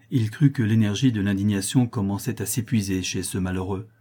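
usual speaking voice

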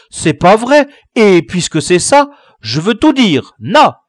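high, loud sulky threat; defiant protest. Acted. Baccaillé threatens to tell all, loud and sulky. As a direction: dig in, childish and defiant. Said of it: "protesta l'accusé".

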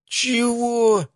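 very high-pitched outraged outburst; indignant yelp, pitch shooting up. A narrator's reading. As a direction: squawk in offended disbelief. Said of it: "уже достаточно громко возмутился Матвей".

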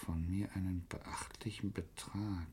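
hushed whisper of a bribe; secretive whispered offer. Acted. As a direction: drop to a whisper for the money. Secret blackmail offer read out.

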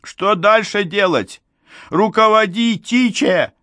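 very high-pitched yelling; high, panicky cry for orders; frantic shouting. A narrator's reading. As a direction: shout desperately for instructions. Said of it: "крикнул Глушков".

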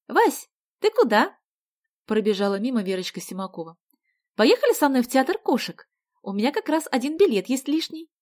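put-on high, loud girl's call; breezy classmate calling out. A narrator's reading. Verochka inviting Vasya to the theatre, high and eager. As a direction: hail a friend in passing. Classmate calls out while running past.

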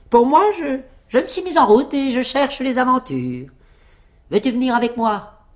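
high-pitched little tailor hailing a comrade; petit tailleur hardi, amical. A narrator's reading. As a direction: chirp an eager invitation to adventure. (petit tailleur hardi, voix perchée)

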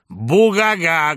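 loud, drawn-out jeering haw-haw; mocking guffaw. A narrator's reading. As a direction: boom out a spelt fake laugh. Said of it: "грянула вся троица и заулюлюкала". (громкий издевательский гогот)

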